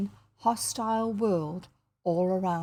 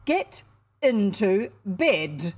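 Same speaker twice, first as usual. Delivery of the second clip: loud, very slow, measured order; icy command; 5 dB louder, much slower than usual. A narrator's reading. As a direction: space out each word with cold authority. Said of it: "said Aunt Elizabeth icily".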